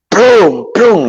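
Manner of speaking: smack out prum prum; slap sound effect. Retold or imitated. Imitating slaps in the face. (onomatopeya de cachetada, fuerte y aguda)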